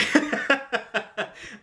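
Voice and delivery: amused chuckle; light laugh at the punchline; short laugh. Real, not acted. Laughing at own joke.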